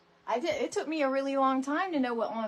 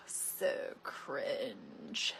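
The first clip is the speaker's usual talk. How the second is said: put-on teen son voice; 10 semitones lower, 6 dB quieter than usual. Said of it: "he was like".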